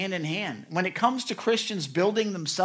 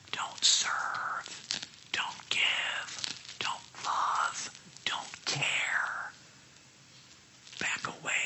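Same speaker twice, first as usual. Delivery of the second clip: soft, insidious whisper, spaced in short phrases; tempting whisper; 8 dB quieter, much slower than usual. Acted. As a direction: whisper it like a tempter luring someone off. Voices the enemy's tempting words.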